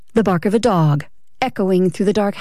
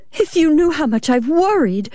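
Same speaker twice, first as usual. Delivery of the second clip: high, upset wife's voice; worried wife; 8 semitones higher than usual. Acted. Wife dabbing at her eyes, worried, high-pitched. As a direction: reproach him with your worry. Tearful wife reproaches husband.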